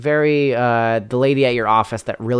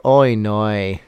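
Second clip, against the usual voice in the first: exaggerated Kiwi "oh no" with oy vowels; New Zealand accent; 3 semitones lower than usual. Shown as a demonstration. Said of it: "New Zealanders are more like".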